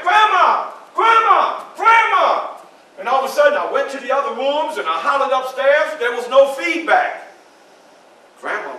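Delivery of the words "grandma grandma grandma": loud, high calls through the house; frightened child calling his grandmother loudly. Acted. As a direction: shout for grandma room to room.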